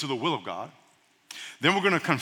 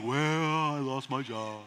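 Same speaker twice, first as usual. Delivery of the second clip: gloomy reply; 4 semitones lower, 7 dB quieter, much slower than usual. Retold or imitated. Imitating a gloomy friend.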